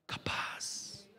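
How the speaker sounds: whispered capaz. Real, not acted. Preacher whispering capaz to the church. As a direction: drop to a hushed whisper on capaz.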